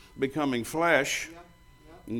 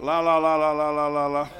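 loud mocking sing-song to drown her out; 6 dB louder than usual. Pastor drowning out a rant, loud sing-song. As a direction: cover your ears and chant la la la.

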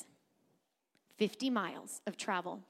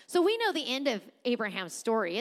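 ordinary talk, nothing marked

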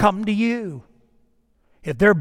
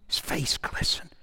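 hushed whisper of awe; 5 semitones lower, 11 dB quieter than usual. Acted. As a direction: drop to a breathless whisper. Quiet awe over Christ's glory.

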